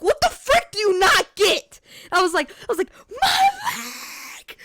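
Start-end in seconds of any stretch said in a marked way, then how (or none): 0.0-1.8 high, loud, drawn-out outburst; indignant reenacted shout, stretched out; exasperated yell. Yelling at smiling bystanders while hurt. 3.2-4.6 very high, wailing mock scream; long high-pitched cry of pain. Reenacting her scream over the injury.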